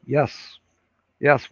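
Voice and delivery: ordinary delivery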